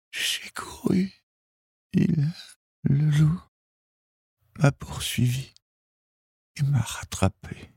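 put-on high girl's voice dropping into whisper; wounded girl, high and fading; failing, breathless voice. A narrator's reading. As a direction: gasp out each piece with long breaks. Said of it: "d'une voix qui faiblissait à chaque instant".

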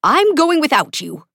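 high, loud ultimatum; exasperated threat to leave; warning. A narrator's reading. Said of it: "Kaz warned".

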